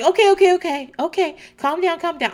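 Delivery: high, hurried mock placating; lightly voiced friend trying to calm a panic; imitating a placater. Marisol calming Mama Elsa down, mock-placating.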